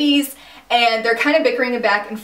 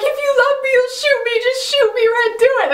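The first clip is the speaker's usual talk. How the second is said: much higher, breathy mock-dramatic plea; mock pleading villain; 14 semitones higher, 3 dB louder than usual. Twin begging Ren to shoot her, much higher hammed-up plea. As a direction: ham up the evil twin begging. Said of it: "and she's like".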